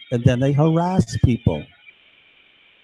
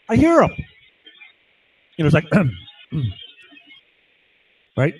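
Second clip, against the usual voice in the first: ordinary talk, nothing marked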